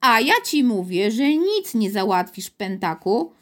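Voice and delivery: ordinary delivery, nothing marked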